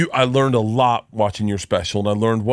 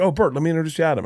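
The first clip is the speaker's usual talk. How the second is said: quick, higher, eager voice of a friend; imitating Nick; 6 semitones higher, much faster than usual. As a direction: play Nick as a hyped-up buddy. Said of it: "Nick would be like".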